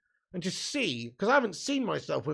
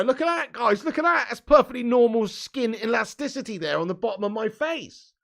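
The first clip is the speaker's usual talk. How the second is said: high, loud and gleeful, showing off his new jawline; excited show-off; 7 semitones higher, 7 dB louder than usual. Real, not acted. Streamer showing his slimmer face to chat, excited and loud. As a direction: proudly point it out to the viewers, half laughing.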